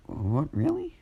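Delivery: surprised mother. Acted.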